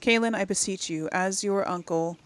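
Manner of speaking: ordinary talk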